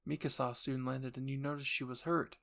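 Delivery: ordinary talk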